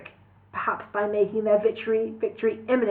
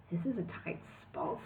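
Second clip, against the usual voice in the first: whispered; 3 semitones lower, 16 dB quieter than usual. A narrator's reading.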